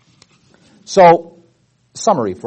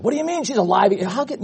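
incredulous; 6 semitones higher, 4 dB quieter, faster than usual. Acted. Acted quote of astonished townspeople.

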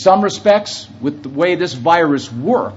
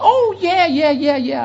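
put-on higher, light girlish voice for her quick yeahs; imitating the young girl; 11 semitones higher than usual. Preacher quoting the girl in the elevator, high girlish voice. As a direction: play the college girl chirping back.

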